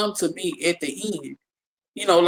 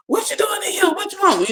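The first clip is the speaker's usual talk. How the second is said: put-on much higher, puzzled old grandma asking; 13 semitones higher, faster than usual. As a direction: play the woken grandmother, pitched way up. Grandma voiced in story.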